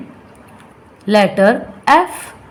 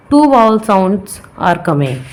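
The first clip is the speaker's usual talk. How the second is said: ordinary delivery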